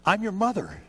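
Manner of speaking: high, loud mock insistence; insistent mother. Preacher showing what mom needn't say to baby, loudly insistent. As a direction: overdo it as a mother demanding to be recognized. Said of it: "Doesn't have to say".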